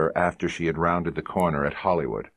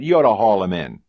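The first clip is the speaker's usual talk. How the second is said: louder, higher insistence; pushy partner; 5 semitones higher, 8 dB louder than usual. Acted. Fat partner to the sergeant, loud insistence. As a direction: push the sergeant hard.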